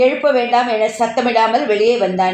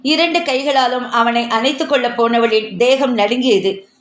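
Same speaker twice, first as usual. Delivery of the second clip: ordinary delivery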